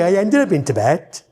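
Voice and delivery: normal talking voice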